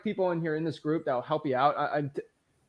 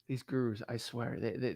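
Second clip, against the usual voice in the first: soft, drained muttering; weary mutter; 7 semitones lower, 13 dB quieter than usual. Real, not acted. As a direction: mutter to yourself, fed up. Exasperated muttering.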